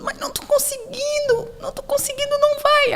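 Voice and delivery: little daughter struggling with the doll, high frustrated whine; high little girl whine. Retold or imitated. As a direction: whine like a little girl who can't make it fit.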